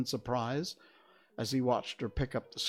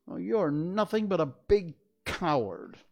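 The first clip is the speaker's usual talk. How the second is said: scornful taunt; 7 semitones higher, 5 dB louder than usual. A narrator's reading. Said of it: "she retorted".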